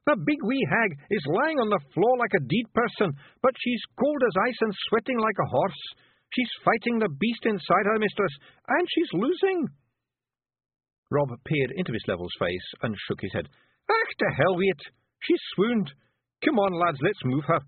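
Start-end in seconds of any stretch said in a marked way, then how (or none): 0.1-9.7 high, loud urgent Feegle report; yell. Acted. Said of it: "Rob Anybody yelled". 13.9-17.6 high, rough little Scottish pictie, gently; a tiny Scots warrior breaking bad news; Feegle voice. Rob gives orders.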